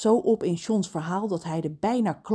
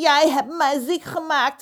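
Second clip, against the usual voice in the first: calling pirate; 7 semitones higher, 4 dB louder than usual. Acted. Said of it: "riep Pedro".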